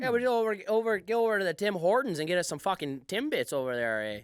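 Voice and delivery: hammed-up Canadian with eh; Canadian accent. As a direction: brag about Tim Hortons like a local. Canadian bit about Timbits.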